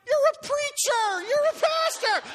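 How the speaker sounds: high, slow mock outrage; crowd protesting. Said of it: "Everybody went".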